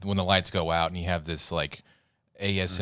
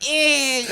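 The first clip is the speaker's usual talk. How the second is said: very high, loud mock shriek; piercing high-pitched squeal, played for laughs; girlish scream imitation; 8 dB louder than usual. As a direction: imitate a big man squealing like a girl. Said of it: "This dude screamed like a girl".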